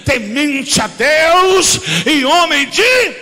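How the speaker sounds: loud, rhythmic sermon shout; full-force preaching. Acted. Pastor preaching loudly on being a true man of God. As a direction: preach it hard to the congregation.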